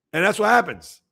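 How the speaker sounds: normal talking voice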